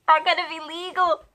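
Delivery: excited. Real, not acted. Excited about turning twenty-one.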